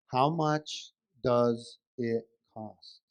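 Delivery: "How... much... does... it... cost", very slow and low; low, strained-patience "How much does it cost", each word separate; exaggeratedly slow question. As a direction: spell it out word by word, as if not understood. Exasperated husband repeats the question slowly.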